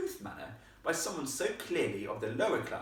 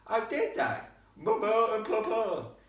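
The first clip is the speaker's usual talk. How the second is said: high, loud mimicry of posh words; mock her poshness, drawing out the words; smug boy slowly aping a posh girl; smug mockery; 12 semitones higher, 8 dB louder, slower than usual. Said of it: "replied in a rather smug tone".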